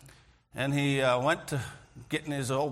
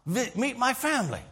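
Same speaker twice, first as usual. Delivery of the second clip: higher, eager, bubbling over; excited invitation; 8 semitones higher than usual. Retold or imitated. Farmer's host eagerly invites the builder home, excited and high. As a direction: invite him in with sudden delight. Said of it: "got all excited".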